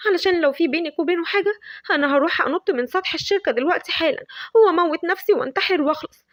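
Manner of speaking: melodramatic threats, pushing for gossip; dramatic Shams. Acted. Shams pressing Lavender about Marwan, hammed-up drama.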